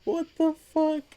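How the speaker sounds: high-pitched, baffled sputter; acted-out high bewilderment; mock disbelief. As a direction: react in squeaky, stunned confusion. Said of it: "And I'm like".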